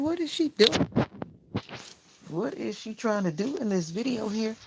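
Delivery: high-pitched mock disbelief; imitating own bewildered reaction; retold puzzled reaction. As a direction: jump up high as if baffled by a video. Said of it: "'Cause I was like".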